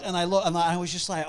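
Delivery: ordinary delivery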